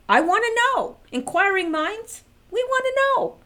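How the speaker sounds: high, loud and eager; really wanting to know. Real, not acted. Eager curiosity, raised voice.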